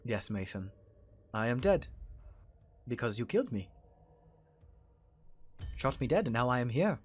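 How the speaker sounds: put-on deliberate accented menace with long pauses; Larkin's accent. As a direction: threaten calmly in a foreign accent. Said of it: "in his accent he says".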